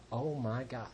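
very soft, low awe; hushed amazement. Retold or imitated. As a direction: breathe it low, stunned by the sight. Myers amazed at seeing Ben.

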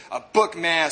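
ordinary delivery

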